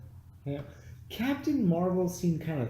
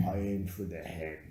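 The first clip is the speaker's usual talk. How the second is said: quoted movie line; 8 semitones lower, 6 dB quieter than usual. Retold or imitated. Movie character quoted in recap.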